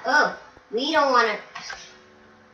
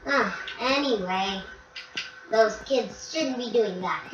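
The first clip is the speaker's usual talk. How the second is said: usual speaking voice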